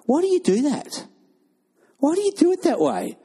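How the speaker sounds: high, puzzled questioning voice; incredulous visitor. Retold or imitated.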